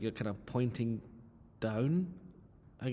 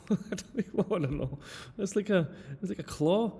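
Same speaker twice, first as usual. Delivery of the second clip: laughing as the words come out, pitch jumping higher; giggly disbelief, voice pitched higher; 8 semitones higher than usual. Real, not acted. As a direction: crack up at the awful drawing. Laughing at student's drawing.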